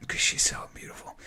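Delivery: hushed breathy aside; whisper. Real, not acted. Comic telling a late-night host about the beautiful girl, whispered. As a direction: let it drop to a whisper, awed.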